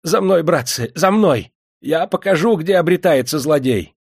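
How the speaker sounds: high, loud eager clerk; rally them with servile eagerness; excited high-pitched toadying; fawning. A narrator's reading. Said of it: "Чиновник залебезил перед ними".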